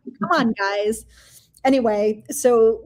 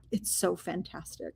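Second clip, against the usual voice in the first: awed hush; 4 semitones lower, 14 dB quieter than usual. Retold or imitated. Friend's awed reply.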